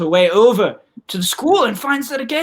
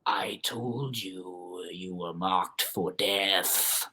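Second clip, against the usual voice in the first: very low, soft, drawn-out doom; eerie owl voices; 14 semitones lower, 13 dB quieter, much slower than usual. Acted. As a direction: intone it slowly like a haunting omen. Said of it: "a voice that seems to emanate from each and every one of them".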